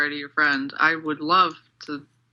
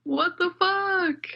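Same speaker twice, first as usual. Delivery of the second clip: high, slow, stunned disbelief; shocked; 12 semitones higher, much slower than usual. Real, not acted. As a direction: drag it out in disbelief. Shocked reaction to story.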